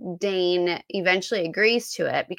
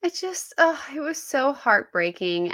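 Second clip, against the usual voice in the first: ordinary talk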